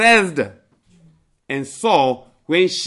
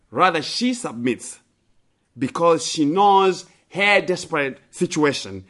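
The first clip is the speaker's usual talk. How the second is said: ordinary talk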